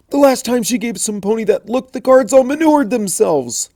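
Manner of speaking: high, rising panic; frantic Spike. Acted. Spike panicking to Rarity about the princess, frantic. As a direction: worry spilling out.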